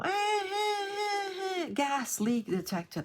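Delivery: very high, slow wailing eh eh beeps; playful imitation of a gas alarm beeping then announcing. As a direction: mimic a shrieking alarm going eh eh eh. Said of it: "they would go".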